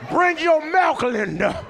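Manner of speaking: high shouted call across the café. Acted. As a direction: holler the order to the back. Barista calling out the order.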